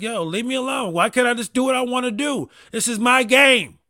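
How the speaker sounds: loud high whine at the son; whiny yell at son. Retold or imitated. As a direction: protest like a frustrated kid, voice climbing. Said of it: "And I'm just like".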